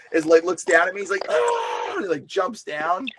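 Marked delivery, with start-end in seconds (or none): 1.3-1.9 very high loud shriek; possessed shout. Retold or imitated. Possessed man atop the pole shrieks down at him. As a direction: scream down from the pole.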